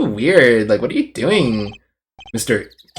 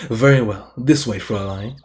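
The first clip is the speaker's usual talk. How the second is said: softly lowered charmer's voice; sexy suitor; 4 semitones lower, 4 dB quieter than usual. Acted. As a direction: usher them on with smooth, quiet charm. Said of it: "it has to be sexy".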